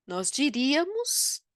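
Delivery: "diríamos" with a rounded French schwa; mock schwa Portuguese. Shown as a demonstration. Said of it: "Senão, nós diríamos".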